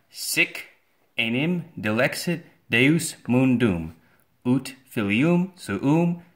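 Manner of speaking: slow, loud, solemn recitation of the verse; slow Latin reading. Shown as a demonstration. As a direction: read the Latin verse out slowly and grandly. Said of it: "why don't we just read it".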